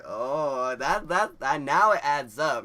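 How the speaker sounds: loud, drawn-out understanding; big mock moment of realizing; dawning realization. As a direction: let it click, loudly and slowly. Cashier realizes they are high.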